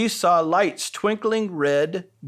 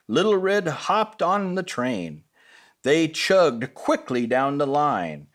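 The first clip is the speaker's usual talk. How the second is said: plain reading voice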